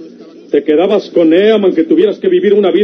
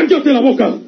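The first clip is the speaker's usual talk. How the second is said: angry command; 7 semitones higher than usual. Acted. Father silencing defiant woman.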